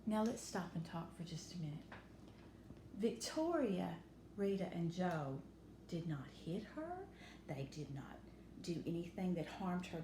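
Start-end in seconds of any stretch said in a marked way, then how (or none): none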